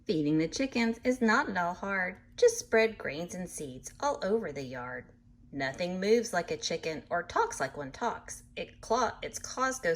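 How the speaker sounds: lively storybook reading voice; animated picture-book narration; reading to children. A narrator's reading. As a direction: read it bright and sing-song for little listeners. Reads book aloud to children.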